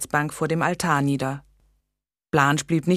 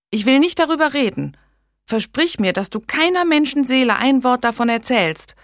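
put-on a young woman's voice, higher and louder, insistent; urgent young lady; 9 semitones higher, 5 dB louder than usual. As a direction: make her swear, urgently. Megan asks Blanche for secrecy.

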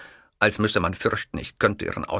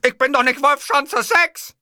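much higher, louder outraged dictator; outraged Hitler; 7 dB louder than usual. Acted. Hitler, outraged at the clerk, loud. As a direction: burst out in wounded pride.

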